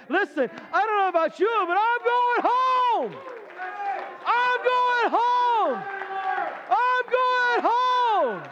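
high, loud, very slow drawn-out shout; preaching at full force. Real, not acted. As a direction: stretch every word like a revival cry. Preacher's rising refrain.

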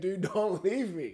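high, whiny mock plea; pleading buddy. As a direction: beg like a scared little kid. Buddy begging.

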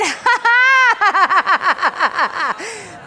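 high cascading laugh, starting loud; exultant laughter. Real, not acted. Preacher rejoicing after claiming victory, exultant laughter. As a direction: burst into a long victorious laugh.

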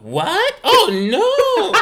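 high, laughing gasp of disbelief; be floored and crack up; shocked grandma. Retold or imitated.

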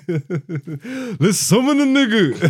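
hammed-up, drawn-out rap line through laughter; laughing mock lyric. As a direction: draw it out, cracking up.

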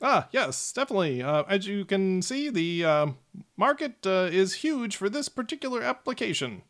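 high, eager salesman gnome; smooth it over like a huckster; high gnome salesman covering for a flop. Acted. Gnome salesman answers the player.

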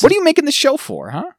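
high, loud comic challenge; indignant, incredulous demand; mock outrage. Mock rant at show makers.

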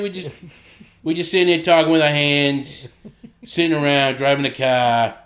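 broad put-on Boston accent; chatty Bostonian, dropping the r's. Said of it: "just gonna do the Boston accent".

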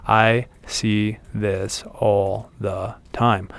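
drawn-out, deliberate emphasis; slow emphatic delivery. Real, not acted. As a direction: stretch every word out slowly for emphasis. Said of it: "I don't know why I wanted to say that slow".

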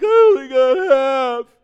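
mock wail, high, loud and drawn out; whining pessimist. Preacher acting the half-empty people, loud mock wail. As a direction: bawl it slowly as a hammed-up complaint. Contrasting two outlooks on same glass.

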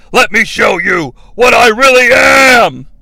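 loud, slow hammed-up roar; slow, loud mock-menacing shout; mock villain yell. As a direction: stretch it out like a transformation cry. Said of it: "He then yells".

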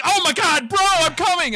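very high, loud frantic yell; hammed-up high screaming rescue call; Charlie Day. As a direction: shout after a brother in mock panic. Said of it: "Charlie Day is gonna be like".

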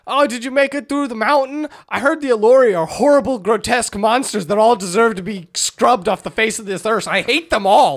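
high, loud, hammed-up tirade; mock hateful rant. Caller ranting about monsters over the rock, hammed-up.

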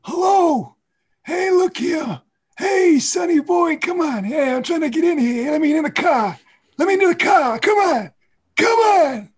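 put-on high, loud cranky old man shouting to get in a cab; hammed-up old man banging on the car. Shown as a demonstration. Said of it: "the old man voice".

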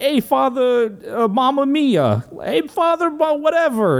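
high, hammed-up Italian-American Rocky; playing Rocky with a cartoon Italian accent; Rocky begging the priest, mock Italian accent. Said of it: "my Italian".

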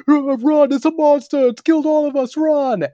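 high, loud panicked yelling; terrified orc. Retold or imitated. Injured orcs warn of a monster, terrified yelling. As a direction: scream a frantic warning to everyone fleeing. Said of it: "terrified looking orcs run out of the forest".

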